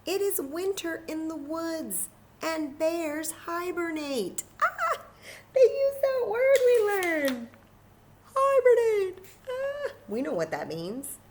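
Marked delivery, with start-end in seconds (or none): 0.1-4.4 a small mouse scolding its friend, high and squeaky. Acted. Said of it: "Mouse squeaks". 4.6-9.9 high, loud delighted squeals; thrilled to hear the word they learned; teacher squealing with joy over a vocabulary word; real excitement. Real, not acted. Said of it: "I got so excited".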